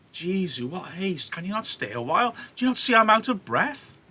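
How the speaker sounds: high, mock-exasperated nurse; playing up breathlessness. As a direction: protest the haste, feigning exhaustion. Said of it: "nurse is playing her up now".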